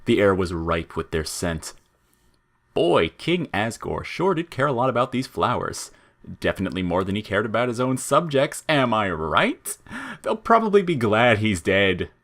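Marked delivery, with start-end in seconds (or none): 2.8-12.1 twisted chirpiness. A narrator's reading. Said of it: "Flowey's usual twisted chirpiness".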